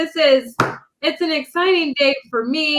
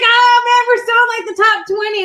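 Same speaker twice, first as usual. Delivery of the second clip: high, louder exclamation of her own reaction; worked-up retelling; 8 semitones higher, 4 dB louder than usual. Retold or imitated. Telling friends how she checked the book ranking, worked-up. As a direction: cry it out high, reliving the moment.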